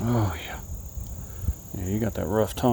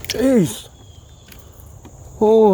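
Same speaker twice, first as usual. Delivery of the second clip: higher, louder jolt of fright; startled; 11 semitones higher, 7 dB louder than usual. Real, not acted. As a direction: jump at a sudden splash. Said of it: "That scared the crap out of me".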